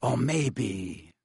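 a cold, low teacher's voice; lightly voiced cold Snape. A narrator's reading. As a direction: chill the words, a stern master behind them. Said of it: "said a very cold voice".

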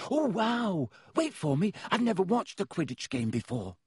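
bursting to show his photo; eager young boy. A narrator's reading. Colin voiced again.

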